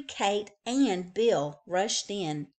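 plain reading voice

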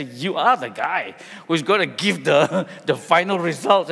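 disbelief breaking into a laugh; shocked, laughing. Retold or imitated. Family realizing the censor grades him, laughing disbelief. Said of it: "completely shocked".

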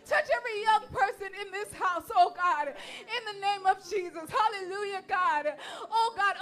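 loud, high impassioned prayer, voice cracking with tears and laughter; fervent prayer. Real, not acted. Minister praying over the young people before the message, loud and tearful. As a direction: cry out to God at full force, overcome.

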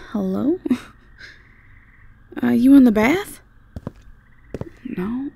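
ordinary delivery

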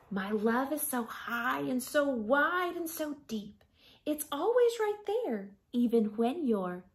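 tender picture book reading; reading to children. Acted. Teacher reads picture book aloud.

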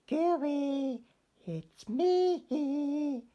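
high, loud little-fish call; Stanley calls to a golden fish, high and loud. Acted. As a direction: call out brightly as tiny Stanley. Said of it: "he called".

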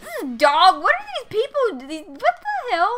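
loud, high-pitched disbelief; baffled outburst. Real, not acted. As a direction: exclaim in rising bewilderment, tripping over words. Startled by dog walking through house.

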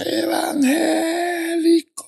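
very high, long-drawn town holler; imitated jeering shout. Townspeople shouting at the young convert, high drawn-out imitated yell. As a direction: stretch the word out in a high mocking call. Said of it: "el pueblo me gritaba".

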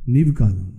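God telling the believer the footprints are not his. Acted. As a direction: speak low as the Lord revealing the truth. God answers in acted dialogue.

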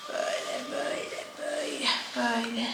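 whispering. Real, not acted. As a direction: breathe it out in a whisper. Whispered during gentle massage.

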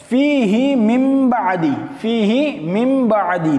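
very slow, stretched melodic recitation; slow Quranic chant with long vowels. Shown as a demonstration. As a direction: draw out every syllable like tilawat. Reciting Quran verse in class.

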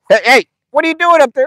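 loud, high-pitched call to an animal. Real, not acted. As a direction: shout up at a misbehaving goat. Calling out to a climbing goat.

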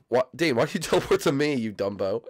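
normal talking voice

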